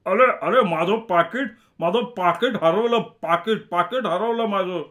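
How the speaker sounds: high, agitated cry of alarm; frantic high voice, repeating the words; panicked shouting. A narrator's reading. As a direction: cry out in a panic over a loss. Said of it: "ओरडला".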